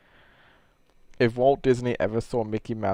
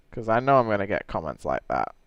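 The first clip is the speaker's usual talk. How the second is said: ordinary talk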